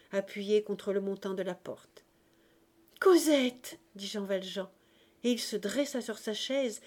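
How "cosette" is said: loud, high cry of her name; startled, joyful exclamation; overjoyed cry. A narrator's reading. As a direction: call out to a beloved daughter just arrived. Father sees Cosette, rises overjoyed.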